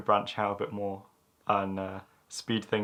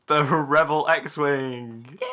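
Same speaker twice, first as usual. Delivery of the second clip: showy announcer voice; 5 semitones higher, 6 dB louder, much slower than usual. Pretend. Announcing in a put-on voice.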